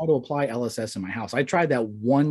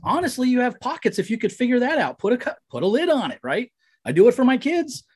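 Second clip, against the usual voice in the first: high-pitched comic rant; hammed-up fed-up customer, pitched way up; mock exasperation; 10 semitones higher, 4 dB louder than usual. Comic rant at slow bartender.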